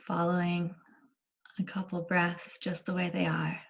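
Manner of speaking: unhurried guiding voice with long pauses between phrases; calm meditation leader, letting each phrase settle; guided meditation. Acted. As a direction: gently guide listeners into body awareness, leaving lots of space.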